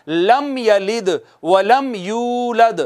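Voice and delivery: slow recited लम यलिद व लम यूलद, meem touched lightly; clear izhar. Shown as a demonstration. Teacher reciting the full verse correctly for students. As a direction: recite slowly without pausing on the meem. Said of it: "रुकेंगे नहीं मीम पर".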